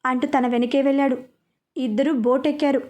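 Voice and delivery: plain reading voice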